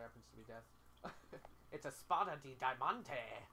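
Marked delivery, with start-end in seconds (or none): 1.7-3.5 slow, loud mock-French flourish; put-on French accent. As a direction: declaim it in a playful fake French accent. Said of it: "I was doing a French accent".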